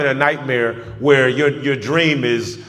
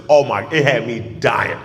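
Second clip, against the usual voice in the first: ordinary talk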